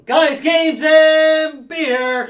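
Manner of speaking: shouted show name, high and loud; shouting. Acted.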